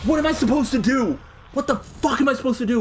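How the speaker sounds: high-pitched, helpless outburst; frustrated gamer complaint, voice pushed up; exasperated. Real, not acted. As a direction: demand an answer in real frustration. Player stuck and exasperated.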